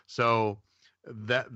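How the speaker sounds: ordinary talk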